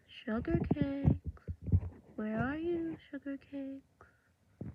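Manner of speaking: coaxing call for someone hiding; hidden-anger sweet calling. Acted. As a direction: call out sweetly, drawing out the last words. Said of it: "sort of show it".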